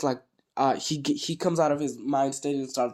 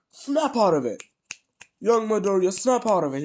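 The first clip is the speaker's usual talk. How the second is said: calling out; 6 semitones higher than usual. Acted. All Might calls Midoriya out.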